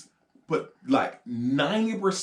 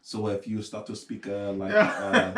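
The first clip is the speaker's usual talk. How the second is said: mock French accent; 4 semitones lower than usual. Shown as a demonstration. Teaching a friend the accent, low mock French. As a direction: slide from pilot drone into French. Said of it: "how you have to do it".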